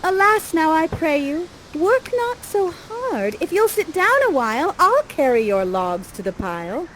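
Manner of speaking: put-on high, entreating young heroine; high-voiced maiden begging him to rest; Miranda. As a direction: plead sweetly in lifted, theatrical verse. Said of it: "and she says".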